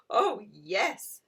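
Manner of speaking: put-on a loud, happy child's yes; shouted. Alfie agreeing to mind the kitten, shouting. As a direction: call it out with delight. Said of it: "shouted Alfie".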